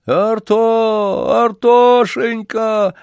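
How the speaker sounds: high, slow, drawn-out howl of a name; plaintive wail. A narrator's reading. Old man crying out for his lost dog, plaintive howl. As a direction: wail for the dog, stretching each call. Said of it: "жалобно завыл старик".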